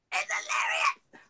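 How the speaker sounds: high, slow excited outburst; excited squeal. Real, not acted. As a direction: squeal it with delight. Gushing about the comic.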